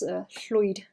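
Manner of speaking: "Lloyd" with a hissed Welsh ll, like llwyd; a Welsh "Lloyd" meaning grey; Welsh word for grey. Shown as a demonstration. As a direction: hiss the ll, then "oyd". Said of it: "That's how you say grey".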